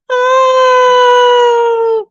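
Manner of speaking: a high, loud, sudden anguished wail; crying wail. Retold or imitated. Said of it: "And I'm crying because".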